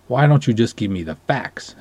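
usual speaking voice

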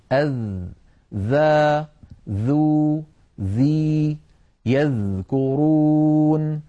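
"أذ، ذا، ذو، ذي" held one by one; slow recited "يذكرون" with a buzzing voiced th; correct dhal drill. Shown as a demonstration. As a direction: model each of "ذا، ذو، ذي" drawn out and distinct. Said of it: "نطقا صحيحا هكذا".

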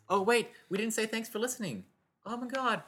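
high-pitched, alarmed outburst; sudden realisation. Real, not acted. Co-host realises the sign-off was forgotten, real surprise. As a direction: catch yourself with a jump in pitch.

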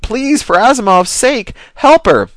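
high, loud pleading shout; robot begging partner to save her; desperate scream. A narrator's reading. Robbie screamed.

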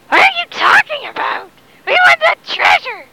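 high-pitched, outraged pirate demand; indignant pirate mob. Acted. Pirates confront Long John Silver over treasure, high indignant demand.

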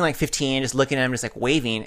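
normal talking voice

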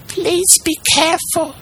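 tiny, very high pleading squeak; wee beseeching voice. A narrator's reading. Piece of wood begging the carpenter, tiny and beseeching. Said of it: "a wee little voice say in a beseeching tone".

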